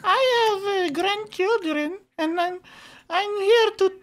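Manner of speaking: high, quavery little granny voice; old lady voice. Acted. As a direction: coo like a sweet old grandma. Prank caller plays sweet old lady.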